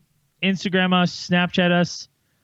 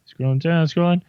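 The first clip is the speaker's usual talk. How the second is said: usual speaking voice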